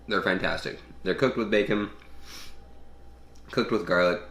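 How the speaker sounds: words round a bite of beans; mouth full. Real, not acted. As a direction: talk while still chewing. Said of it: "talking with my mouth full".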